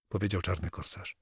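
ordinary delivery

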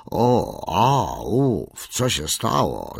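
a hint of a startled, groggy walrus; lightly voiced walrus, jolted awake; grunt, then ask what's going on; walrus calling out. A narrator's reading. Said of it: "zawołał koń morski".

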